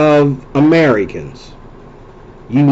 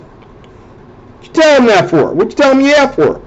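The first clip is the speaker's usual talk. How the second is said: retold scolding question; 7 semitones higher than usual. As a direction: act out asking a boy why he said that. Retold scolding of little boy.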